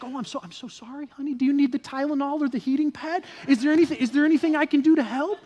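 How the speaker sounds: high, over-solicitous apologizing; mock-frantic, eager-to-help husband-style fussing; flustered apology. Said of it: "then it turns into an apology".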